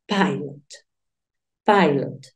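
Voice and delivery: "pilot" said PAI-lot, first vowel as "ay"; pronunciación inglesa. Shown as a demonstration. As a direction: model English "pilot" for a learner, the I gliding like "eye". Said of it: "sería la pronunciación de esta palabra". («pilot» dicho «pái-lot», como en inglés)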